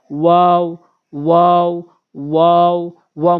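ওয়াও with rounded lips; Arabic waw in ওয়াও, lips in a circle. Shown as a demonstration. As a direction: round the mouth for each ওয়াও. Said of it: "মুখ গোল করে".

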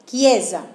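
"Chiesa" said as kiesa, with a hard k; "Chiesa" pronounced kiesa, hard k at the start; Italian ki sound. Shown as a demonstration. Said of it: "se hace con la C H I".

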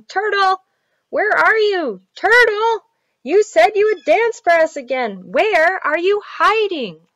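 put-on high, loud children searching; calling out. A narrator's reading. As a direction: call into the distance for a lost friend.

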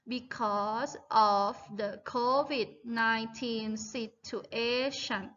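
very slow, even read-along. Shown as a demonstration. Teacher reading slowly along with her students. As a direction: read it slowly so the class can follow you. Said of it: "เราจะค่อยๆอ่านแบบ slow ช้าๆ".

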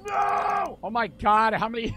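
high, loud wail of no; dismayed cry. Real, not acted. Player seeing threats on the computer, loud dismay.

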